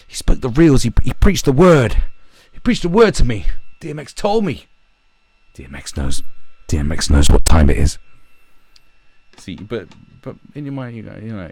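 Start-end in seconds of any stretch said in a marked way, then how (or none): 0.1-4.7 loud, high-pitched fan testimony; mock fervent worship. Fans praising their celebrity afterwards, hammed-up fervour. 5.5-8.0 very loud, emphatic fan proclamation; mock awed proclamation. Fan vouching for a celebrity, loud mock awe.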